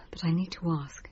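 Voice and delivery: breathy whispered plea. Acted. Patient whispering to her hypnotherapist. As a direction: whisper it as if ashamed to ask. Confiding fear to therapist.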